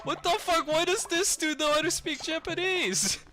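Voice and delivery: high-pitched mock disbelief; astonished. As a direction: imitate baffled onlookers, voice shooting way up. Astonished ladies, imitated.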